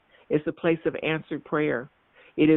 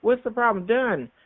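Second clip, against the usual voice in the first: high dismissive; 7 semitones higher than usual. Real, not acted. Preacher dismisses problems.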